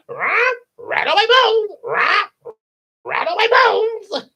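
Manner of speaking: very high, loud screeching parrot; Gilbert Godfrey. Acted. Parrot shows off its phrase, loud high comedian's squawk. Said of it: "Gilbert Godfrey for the parrot".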